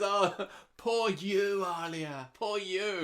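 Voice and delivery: high, loud, very slow pity; mock Yasmin sympathy. Mocking Yasmin cooing over Alia, high and slow. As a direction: drag out the fake sympathy. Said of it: "Yasmin says".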